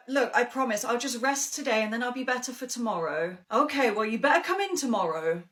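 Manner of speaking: ordinary talk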